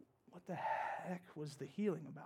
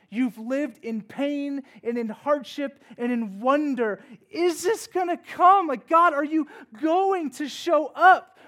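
slow, loud, much higher pleading cry; preaching climax; 10 semitones higher, 15 dB louder, slower than usual. Acted. Pastor voices Israel's longing to God, slow and loud. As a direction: stretch each plea out, crying to heaven.

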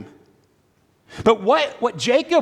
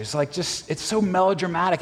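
ordinary delivery

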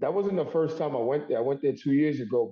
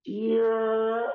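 high put-on catchphrase yell; signature catchphrase; 8 semitones higher than usual. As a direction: belt out the signature call. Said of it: "can't be [name] without going".

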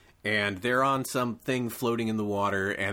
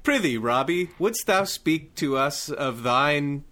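hammy stage actor; 3 semitones higher, 4 dB louder than usual. Acted. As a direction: declaim like an old stage actor. Archaic stage speech.

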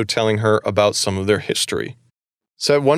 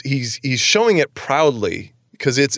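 ordinary delivery

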